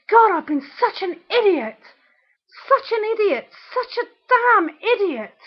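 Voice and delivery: high-pitched, exasperated outburst; exasperated self-reproach. Real, not acted. Regretting time wasted on her ex, exasperated. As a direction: berate yourself, pitch climbing with each repeat. Berating herself in angry rant.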